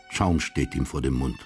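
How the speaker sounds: ordinary talk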